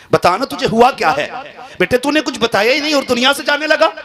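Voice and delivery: a father crying out as his son slips away; a father's loud, high, grieving plea to his only son; wailing father. Acted. Father wails over dying son.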